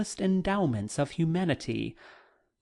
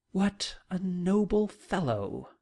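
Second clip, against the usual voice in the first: slower, higher, admiring exclaim; mild exclamation; 4 semitones higher than usual. Pretend. Letter writer imagines his sister's praise, a mild admiring exclaim. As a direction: lightly voice the sister's impressed outburst. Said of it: "you will exclaim".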